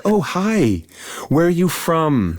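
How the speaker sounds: high-pitched, drawn-out naive small talk; sing-song mock meet-and-greet; mock friendly greeting. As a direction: send up your own oblivious friendliness, up high. Mocks own oblivious friendly chat.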